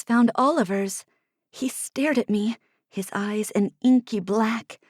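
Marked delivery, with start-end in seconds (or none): none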